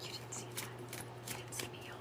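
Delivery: conspiratorial whisper to viewers. Real, not acted. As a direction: whisper it like a secret. Hiding a yawn from viewers.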